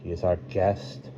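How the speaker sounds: soft, restrained reply; quietly. A narrator's reading. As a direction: answer quietly, well below narrating volume. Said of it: "Corrales said quietly".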